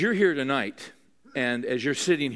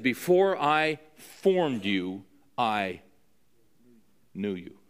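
slow, hushed voice of God; 3 semitones lower, much slower than usual. Acted. Preacher quoting God to Jeremiah, slow and fading to a hush. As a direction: let God's words slow down and fade to a hush.

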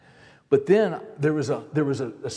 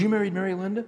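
surprised; 6 semitones higher, faster than usual. Retold or imitated. Caller amazed at wife's name.

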